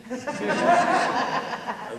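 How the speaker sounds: a loud, bright burst of laughter; hearty laugh. Acted. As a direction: laugh out openly at your own boast. King laughs while wooing.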